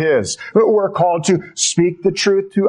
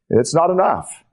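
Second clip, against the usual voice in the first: normal talking voice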